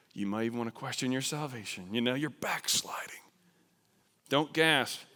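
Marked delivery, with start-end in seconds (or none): 1.9-3.2 scandalized whisper. Acted. Shocked churchgoer whispering at the missionary. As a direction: whisper it in mock outrage.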